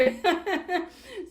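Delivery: short amused laugh. Real, not acted. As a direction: laugh at your own admission. Laughs after "deep introvert.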